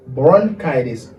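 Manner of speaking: "bronchitis" slow, as "bron-KY-dis"; correct pronunciation. Shown as a demonstration. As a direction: model BRONCHITIS with a long KY in the middle. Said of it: "E pa bronchitis".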